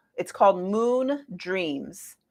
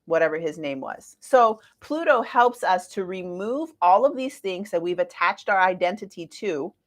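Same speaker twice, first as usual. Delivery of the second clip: ordinary talk, nothing marked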